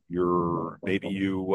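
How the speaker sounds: ordinary delivery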